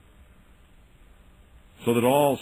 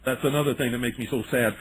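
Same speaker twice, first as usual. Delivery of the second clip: ordinary talk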